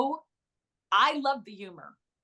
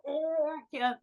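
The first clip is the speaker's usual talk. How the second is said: crew cracking up; 6 semitones higher, 4 dB quieter, slower than usual. Retold or imitated. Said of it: "The crew would be like".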